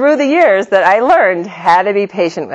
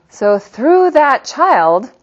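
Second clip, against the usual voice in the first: ordinary talk, nothing marked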